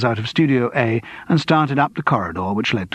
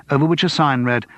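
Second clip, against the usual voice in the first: ordinary delivery, nothing marked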